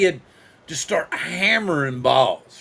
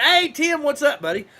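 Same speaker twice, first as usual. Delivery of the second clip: high, louder mock holler; retold yelling; 10 semitones higher, 4 dB louder than usual. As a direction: call out like the shouting man, starting high and dropping. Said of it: "he comes out screaming".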